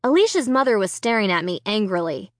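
usual speaking voice

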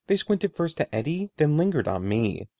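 plain reading voice

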